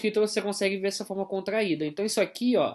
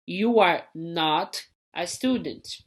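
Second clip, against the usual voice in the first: slow, careful English example; teacher modelling a negative; slow model sentence; much slower than usual. Shown as a demonstration. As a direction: read it slowly, not clearly placed. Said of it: "Você não é aluno".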